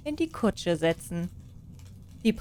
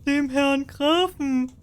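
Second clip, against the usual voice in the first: loud, higher, slow chorus shout; peasants calling out; 6 semitones higher, 4 dB louder, slower than usual. Acted. Peasants call out to the king, loud and slow. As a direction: call it out together as the crowd. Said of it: "riefen alle".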